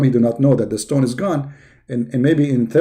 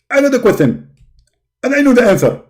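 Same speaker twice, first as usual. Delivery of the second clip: high, loud, indignant preacher voice; Zakir Naik impression; 8 semitones higher, 4 dB louder than usual. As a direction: bark it like a self-righteous debater. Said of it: "My name is Zakir Naik".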